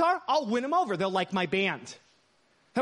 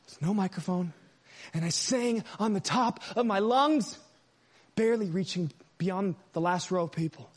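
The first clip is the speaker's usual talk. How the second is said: normal talking voice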